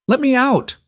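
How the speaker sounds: insist, near yelling; desperate demand. A narrator's reading.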